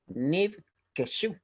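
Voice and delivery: "nivkeshu" slowly, split after "niv"; slow reading demo. Shown as a demonstration. Teacher reading a new Hebrew word, slow split "nivkeshu". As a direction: sound out "nivkeshu" in separated syllables. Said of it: "esta palabra que se lee como".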